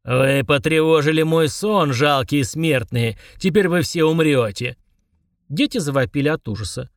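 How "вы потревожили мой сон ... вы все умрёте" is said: slow menacing monster voice, unlike narrator; a towering genie bellows at mortals; roaring giant. Acted. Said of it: "заревел".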